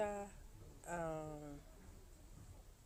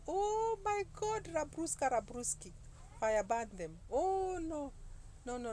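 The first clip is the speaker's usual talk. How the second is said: retold horrified outcry; hammed-up horror; 8 semitones higher, 4 dB louder than usual. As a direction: wail in mock dismay, stretching the vowels. Retold shock at pastor's fiery style.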